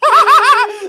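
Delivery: loud shrieking laugh; high squealing laugh. Real, not acted. Laughing at own joke.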